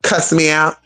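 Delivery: ordinary delivery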